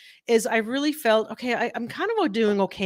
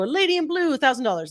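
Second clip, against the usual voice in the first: auctioneer call; 6 semitones higher than usual. Retold or imitated. Auctioneer calling the bid to the room, high calling voice. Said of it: "the auctioneer go".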